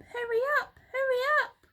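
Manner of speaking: high, loud urging monkey voice; urgent hurry. Acted. Mugglewump hurrying his helpers, high urgent cry. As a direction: hustle them along. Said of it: "cried Mugglewump".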